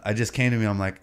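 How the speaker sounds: ordinary delivery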